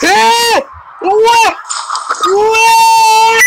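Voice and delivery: surprised shouting. Real, not acted. Shouting at the game.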